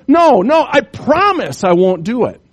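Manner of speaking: loud, high pleading promise; insistent scorpion. Acted. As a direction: swear it's true, pushing hard.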